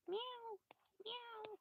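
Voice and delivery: squeaky high meows, mock kitten; high cat meow. Creature at the door, faking a cat, high meow.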